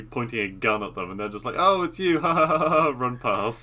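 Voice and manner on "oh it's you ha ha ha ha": higher, louder, easygoing chuckle through it; laughing greeting. Retold or imitated. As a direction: laugh it off like nothing's wrong. Kids cheerfully greeting armed cop.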